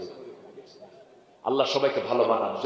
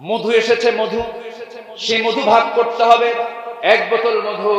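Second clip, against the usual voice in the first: high, loud declamatory sermon chant; ringing preacher's delivery; full-force preaching; 10 semitones higher, 10 dB louder than usual. Acted. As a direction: proclaim it to the whole gathering on a high held pitch.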